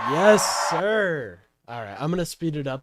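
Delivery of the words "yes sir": enthusiastic cheer. Real, not acted. Cheering the ad's closing line.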